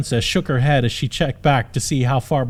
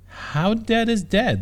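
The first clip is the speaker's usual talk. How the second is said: higher, slow worried question; concerned; 7 semitones higher, slower than usual. Acted. Princess asking the knight, higher worried voice. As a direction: ask it with rising worry. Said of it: "her face filled with concern".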